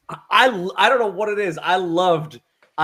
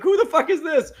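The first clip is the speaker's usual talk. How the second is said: a higher, puzzled blurt; mock confusion; 10 semitones higher than usual. Podcaster voicing the hero's confusion at a stranger, higher. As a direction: toss it off like a baffled hero.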